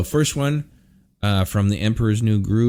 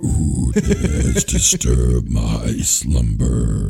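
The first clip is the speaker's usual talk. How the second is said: very deep, very slow booming voice; Cave of Wonders voice; 10 semitones lower, much slower than usual. Acted. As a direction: rumble it out like a giant cave. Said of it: "that was really good".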